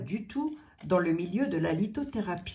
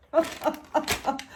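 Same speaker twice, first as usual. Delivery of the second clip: high amused laugh; 7 semitones higher than usual. Real, not acted. Laughs at Nathalie's excess.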